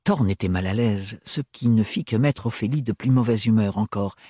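plain reading voice

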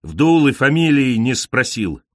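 usual speaking voice

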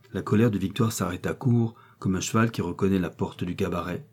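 ordinary delivery, nothing marked

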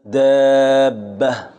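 دابة said very slowly, long a and doubled b held; drawn-out dabba. Shown as a demonstration. Teacher demonstrating دابة slowly for students. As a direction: stretch out دابة with the long alif and stressed bb. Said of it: "أو تقول مثلًا".

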